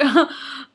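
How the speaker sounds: short high laugh; bright laugh. Real, not acted. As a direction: burst out laughing. Laughs at own mouthful.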